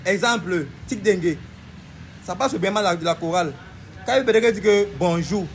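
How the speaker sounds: normal talking voice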